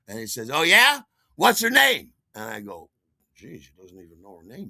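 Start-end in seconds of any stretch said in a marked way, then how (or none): 0.5-2.1 loud, high challenge; irritated boyfriend raising his voice; terribly annoyed boyfriend. Retold or imitated. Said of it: "he is terribly annoyed". 3.3-4.7 very soft, low-key mumble to himself; hushed, embarrassed realisation; sheepish aside. Retold or imitated. Sheepish aside when challenged.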